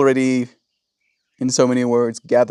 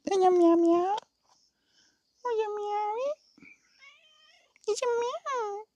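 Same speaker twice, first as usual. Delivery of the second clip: very high, soft cooing pet voice; 9 dB quieter than usual. Real, not acted. Vlogger petting the cat, soft high baby talk. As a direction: coo softly and high to the cat.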